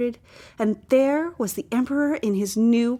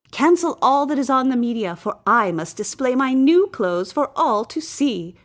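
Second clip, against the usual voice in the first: louder, higher royal command; demanding emperor; 4 semitones higher, 6 dB louder than usual. A narrator's reading. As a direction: bark it like a vain king used to obedience. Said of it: "demanded the emperor".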